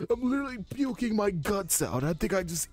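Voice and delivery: high, miserable hungover whine; sick drunk girl. Retold or imitated. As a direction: groan like you're puking drunk. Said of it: "And then two minutes later, they're in the toilet".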